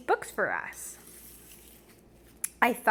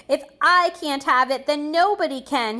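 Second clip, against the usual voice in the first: spiteful outburst; 4 semitones higher, 5 dB louder than usual. Acted. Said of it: "He said something awful and horrible".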